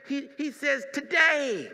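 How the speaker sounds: "today" drawn out slow and shouted; stress on today. Acted. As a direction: lean hard on "today". Preaching emphasis on today.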